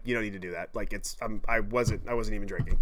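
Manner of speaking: imitating timid friend. Sober friend murmuring apologetically.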